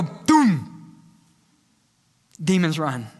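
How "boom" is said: a mock cannon boom; a big firework blast for the Spirit. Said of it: "hear the Holy Spirit go".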